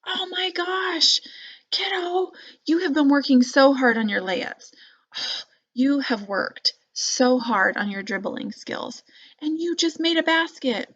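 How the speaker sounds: high, slowed, delighted encouragement; excited encouragement. Shown as a demonstration. Parent after a basket, encouraging the kid with delight. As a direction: marvel at the kid's hard work. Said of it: "I could say".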